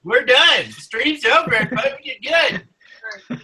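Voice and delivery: high excited mock sign-off. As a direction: pretend to end the show. Mock ending the stream.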